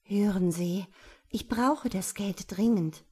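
ordinary delivery, nothing marked

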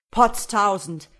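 loud, drawn-out startled exclamation; verblüffter Ausruf. A narrator's reading. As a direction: burst out slowly and loudly in astonishment. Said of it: "rief der junge Bauer und sprang auf". (lauter, gedehnter Ausruf des Staunens)

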